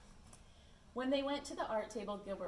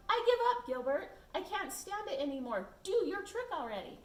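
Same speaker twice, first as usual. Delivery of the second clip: much higher, loud desperate yell; frantic shout; 10 semitones higher, 6 dB louder than usual. Acted. Lewis shouting at Gilbert, high loud desperation. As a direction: beg him to end the waiting. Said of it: "Lewis finally shouted".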